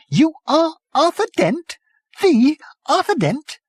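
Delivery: very high, delighted disbelief; stunned to meet him; amazed. A narrator's reading. Prack to Arthur Dent, very high and amazed.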